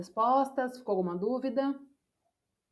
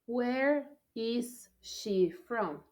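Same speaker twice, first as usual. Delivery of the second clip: Where is she from said slowly, each word spaced; slow model; 3 dB quieter, much slower than usual. Shown as a demonstration. Teacher reading the drill to the class, very slow. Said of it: "teacher models slowly".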